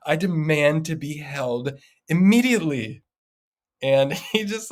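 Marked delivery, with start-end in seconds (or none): 0.1-3.0 put-on slow, imperious little-girl demand, a bit higher; mock regal toddler insisting. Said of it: "that intent and that aggressive".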